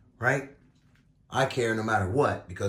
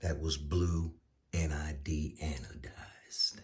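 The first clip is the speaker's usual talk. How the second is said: whispered aside; hushed, confidential mention; 9 semitones lower, 9 dB quieter than usual. Real, not acted. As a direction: drop to a whisper for the brand. Quiet aside about part color.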